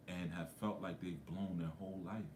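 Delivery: ordinary talk, nothing marked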